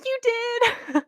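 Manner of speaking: high, delighted burst of surprise. Retold or imitated. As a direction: squeal it like the proudest mentor alive. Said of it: "he's really delighted".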